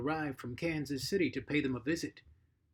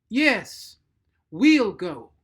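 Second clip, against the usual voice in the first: much louder, higher declaration; booming; 5 semitones higher, 13 dB louder, much slower than usual. Acted. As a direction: boom it from the doorway. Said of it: "boomed Mattie".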